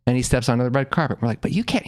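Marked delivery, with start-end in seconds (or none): 1.4-1.9 put-on protesting kid. Kids protesting.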